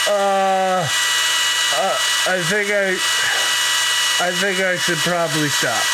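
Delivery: high, strained panic; panicked, high and loud. Real, not acted. Nose rinse, panicked.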